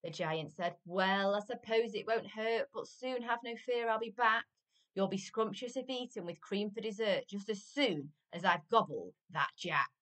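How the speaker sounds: lively, emphatic rhyming storytime voice; story reading to children. A narrator's reading. Adult reading a giant story to children, animated storytelling. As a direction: read it out with bouncing, punched words. Reads picture book to children.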